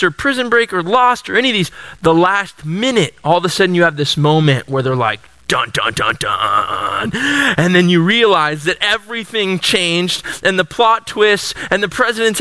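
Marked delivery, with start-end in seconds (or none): 5.5-7.1 low, deep dun dun dun like a TV cliffhanger; mock dramatic TV sting sound. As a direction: hum the ominous cliffhanger music, deep and low.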